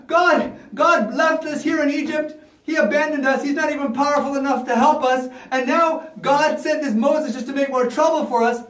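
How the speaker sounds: high-pitched, aggrieved mock lament; complaining. Israelites complain God abandoned them, high lament. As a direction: bemoan it as the abandoned slaves. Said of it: "I could hear them complaining all over".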